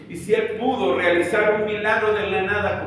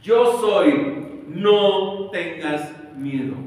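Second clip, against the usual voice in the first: louder, slow on no tengas miedo; commanding voice of God; 2 semitones higher, 5 dB louder, much slower than usual. Acted. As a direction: call out over the storm. God's voice through the storm. (voz potente de Dios)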